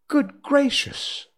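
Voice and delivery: high, drawn-out exclamation; astonished cry. Acted. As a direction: cry out loudly in slow amazement. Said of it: "she cried".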